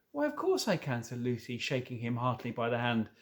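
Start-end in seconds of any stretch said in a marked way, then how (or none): 0.1-1.0 put-on bright little girl voice. High girl voice.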